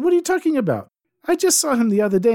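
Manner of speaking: high, loud, fast disbelief; incredulous. Acted. Nobleman scoffs at his guard, high and incredulous. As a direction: scoff at the news.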